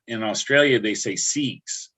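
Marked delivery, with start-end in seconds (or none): 1.2-1.9 "six" said slowly with a long stretched E; Australian accent on "six", drawn out slow. Shown as a demonstration.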